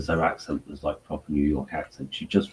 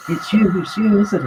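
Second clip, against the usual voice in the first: put-on high, loud New York accent squeal; starstruck New York accent; 8 dB louder than usual. Drunk New Yorker recognising [name], New York accent. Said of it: "And she said".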